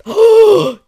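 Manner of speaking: big, loud gasp of horror; real, loud intake of breath; shocked gasp. Real, not acted. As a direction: gasp sharply in disbelief. Shocked on reading the quote.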